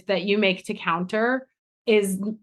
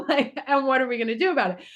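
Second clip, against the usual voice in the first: laughing; 5 semitones higher, much faster than usual. Real, not acted. Laughing while speaking.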